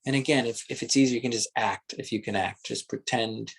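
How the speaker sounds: soft, unhurried meditation guidance; guided meditation. Acted. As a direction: lead them gently inward, taking your time.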